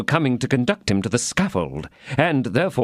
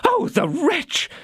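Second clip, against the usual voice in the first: indignant outburst; much slower than usual. Acted. Husband curses runaway wife.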